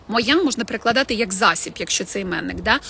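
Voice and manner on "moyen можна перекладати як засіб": normal talking voice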